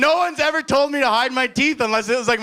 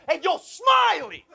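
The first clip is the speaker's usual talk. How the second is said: much higher, slow mocking shout; street call-out; 10 semitones higher, slower than usual. Stranger heckling a smiling newcomer, slow mocking shout. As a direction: call him out across the street. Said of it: "I heard this guy go".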